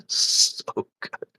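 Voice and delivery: whispered wheeze of delight; breathless laugh. Real, not acted. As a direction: barely get it out laughing.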